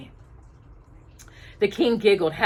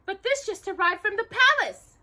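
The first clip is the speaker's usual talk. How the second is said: much higher, eager exclamation; exclaiming. A narrator's reading. Cinderella shows the palace letter, excited exclaim. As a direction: announce the news breathlessly. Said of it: "Cinderella exclaimed".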